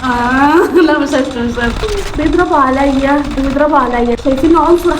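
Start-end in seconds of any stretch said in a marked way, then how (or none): none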